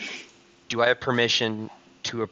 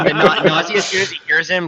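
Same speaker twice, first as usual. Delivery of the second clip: laughing; 9 semitones higher, 10 dB louder than usual. Real, not acted. Excited laughing right after being caught.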